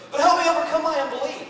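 high, loud plea; pained father. Acted. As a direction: plead urgently for help. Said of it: "Because of all this pain".

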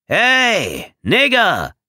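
loud, high shouted hail; calling out. A narrator's reading. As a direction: yell it across the field. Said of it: "rief der Mann aus Mississippi".

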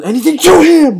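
building to a loud high yell; exasperated gamer yell; frustrated outburst. Real, not acted. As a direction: start annoyed, shout the end. Frustrated at the game.

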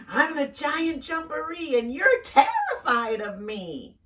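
high, loud, put-on monster boast. Hidden creature scaring Rabbit from the burrow, loud showy boast. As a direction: boom it out to sound scary. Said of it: "came the loud voice".

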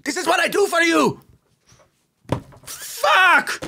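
high strained yell, then a pained curse; strained shout. Real, not acted. Shouting at chat despite a strained neck, high yell. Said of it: "strained a muscle ... when I was shouting".

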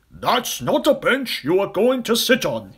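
put-on Trunky warning the children loudly; elephant bellow. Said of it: "he bellowed".

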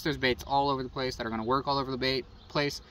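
ordinary talk

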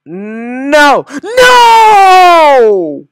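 rising no into a long high wail; despairing scream. Real, not acted. Gamer failing after a success, despairing scream. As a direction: howl NO in despair.

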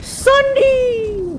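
high excited call of a name; sudden happy recognition; excited shout. Real, not acted.

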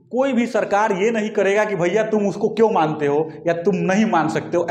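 ordinary talk, nothing marked

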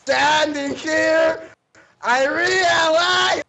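slow, loud and high; mock dramatic proclamation. Player making a hammed-up slow declaration. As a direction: drag it out grandly.

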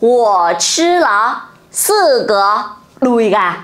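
Wo chi le sư gờ said very slowly; slow model sentence. Shown as a demonstration. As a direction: say Wo chi le sư gờ word by word. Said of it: "cô sẽ nói làm sao nhỉ".

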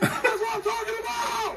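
loud high drawn-out yell; shouting. Real, not acted. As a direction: roar it across the stands. Yelling after a point.

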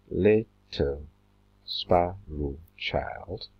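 drawn out very slowly, syllable by syllable; counting syllables. Shown as a demonstration. Teacher counting syllables aloud for the class, very slow. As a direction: stretch each syllable to count it. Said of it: "How many syllables?".

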